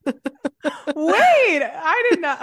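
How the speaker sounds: laughing. Real, not acted. Laughing after the dragon remark.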